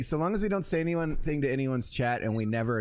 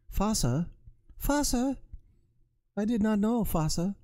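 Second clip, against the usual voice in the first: put-on high-pitched German-accented shepherd dog; 8 semitones higher than usual. German shepherd speaking to its father, high German accent. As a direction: play a talking dog in a hammed-up German accent. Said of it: "Speaking of Germans, I've got my shepherd".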